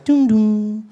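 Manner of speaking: Spanish GPS voice. Retold or imitated. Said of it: "She's like".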